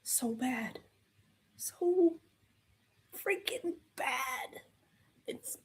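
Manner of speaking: slow whisper of disgust; hushed, drawn-out groan of disgust; despairing whisper. Real, not acted. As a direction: breathe it out in quiet despair. Despairing over the film.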